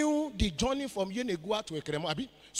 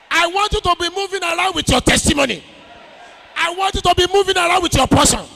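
loud, high rallying shout to the congregation; booming prophetic declaration, building higher; full-force preaching; 9 semitones higher, 15 dB louder than usual. Real, not acted. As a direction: rouse the crowd, then thunder out blessings.

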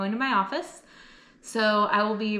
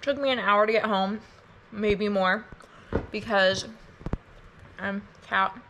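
eating while talking; mouth full. Real, not acted. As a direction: talk through a mouthful. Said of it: "I'm eating chocolate caramels right now".

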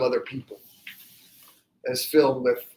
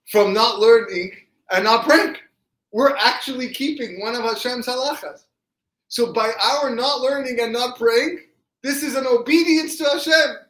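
dawning epiphany; 10 semitones higher, 4 dB louder than usual. Acted. Rav Elimelech reveals his epiphany to his brother, building exultation. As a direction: start measured, climb steadily into exultant wonder. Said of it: "he had an incredible, incredible epiphany".

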